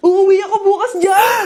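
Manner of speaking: loud, high burst of excitement; excited announcement. Acted. Daughter announcing her homecoming to mother, excitedly. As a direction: blurt out the happy news.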